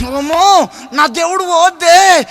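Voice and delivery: beat-your-chest lament, hammed up; mock wailing. Widow refusing to let the body go, loud mock wailing. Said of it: "రొమ్మంతా గుద్దుకొని".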